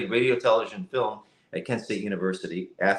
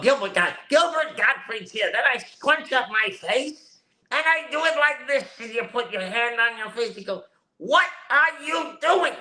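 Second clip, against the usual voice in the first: much higher, squinched comic voice; Gilbert Gottfried impression; 12 semitones higher than usual. Voice actor demos his celebrity impression for the host, high squawk. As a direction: scrunch your face and squawk it out high. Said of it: "If I'm doing Gilbert Gottfried".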